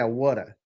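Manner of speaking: "whata" as a regional "water"; accented "whata" for water; Philly pronunciation. Shown as a demonstration. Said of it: "They say".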